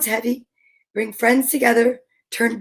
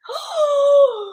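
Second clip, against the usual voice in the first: teary gasp; 12 semitones higher than usual. Real, not acted. Overwhelmed by the kind gift.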